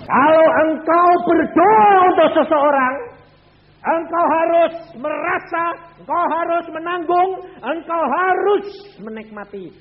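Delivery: high, loud, ringing sermon climax; full-force preaching. Acted. Preacher urges the congregation to carry the suffering of the prayed-for, in a high, loud preaching voice. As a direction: drive the point home from the pulpit.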